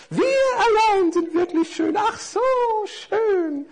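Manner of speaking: hohe, singende, eingebildete Stimme; eitles Lametta. Acted. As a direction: selbstverliebt vor sich hin säuseln. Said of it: "sangen die Lametta-Fäden vor sich hin". (hoch und eitel singsangend)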